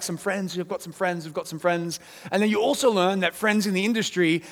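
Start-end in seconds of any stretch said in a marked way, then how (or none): 0.0-2.0 trailing off, softer each time; sheepish repetition. Retold or imitated. Couple excusing the budget to Kevin, softer and sheepish. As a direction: mumble it like an excuse. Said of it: "the couple's always like".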